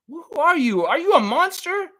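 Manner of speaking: high, hammed-up shocked voice; mock outraged scold. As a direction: pretend to be appalled at him. Mock scolding of his son.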